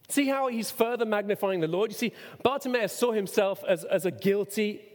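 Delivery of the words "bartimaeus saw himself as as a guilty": normal talking voice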